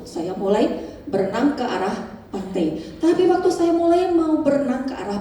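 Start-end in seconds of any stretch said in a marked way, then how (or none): none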